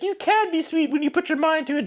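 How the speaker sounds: high put-on coy voice; coy Kitty voice. Kitty praising the suitor, high coy put-on voice.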